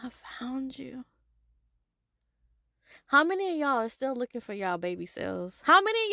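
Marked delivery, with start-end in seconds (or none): 0.0-1.0 whispered, slow and tender; mother softly whispering to her found child. Pretend. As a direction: hold her close and breathe it. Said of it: "I'm like".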